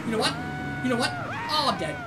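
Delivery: ordinary talk, nothing marked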